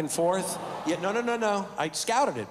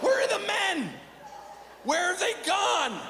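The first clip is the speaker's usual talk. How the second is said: mock lament; 13 semitones higher than usual. Real, not acted. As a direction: shout it up high to the heavens. Comic lament about missing men.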